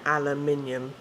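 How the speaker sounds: "aluminium" as al-uh-MIN-ee-um; measured "aluminium", stress on MIN; British aluminium. Shown as a demonstration. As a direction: say "aluminium" in five English syllables. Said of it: "So we say minium".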